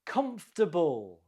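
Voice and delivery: "Comfortable" with the first syllable stressed, the rest reduced. Shown as a demonstration. Teacher models the word in a pronunciation round, first-syllable stress on "Comfortable". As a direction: model "Comfortable" as three syllables, COMF-ta-ble. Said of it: "The stress is on the first syllable".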